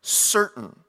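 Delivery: preacher drives home the word "Certain" to the congregation; stress on certain. Shown as a demonstration. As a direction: hit "Certain" alone, then pause. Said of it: "I want to keep stressing that word to you".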